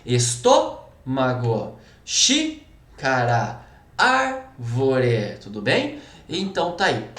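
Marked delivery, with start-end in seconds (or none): 0.0-5.4 slow esTÔmago, XÍcara, ÁRvore with the stressed syllable held; antepenultimate stress. Shown as a demonstration. Teacher giving examples of proparoxytones, antepenultimate stress. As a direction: hit TÔ, XÍ and ÁR, then drop low on the endings. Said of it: "a sílaba tônica vai ser a antepenúltima".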